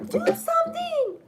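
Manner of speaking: very high, loud panicked shriek; screaming movie victim. Retold or imitated. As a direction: scream for help like in a film. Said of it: "she was like".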